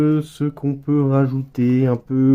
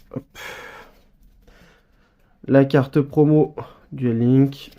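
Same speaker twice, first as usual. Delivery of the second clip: ordinary delivery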